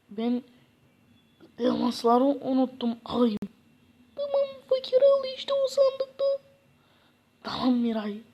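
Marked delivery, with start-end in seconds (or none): 4.1-6.2 high, sweet put-on wife voice; a loving wife seeing her husband off, much higher. Wife sends Fakir off to find work, high loving voice. Wife tells him where diamonds are.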